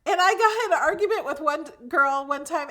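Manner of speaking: ordinary delivery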